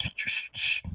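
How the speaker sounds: soft, whispered shushes; urgent shushing. Acted. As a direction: hush the child quickly. Father hushes his child.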